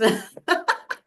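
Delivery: short high laugh; a quick bright laugh; light laugh. Real, not acted.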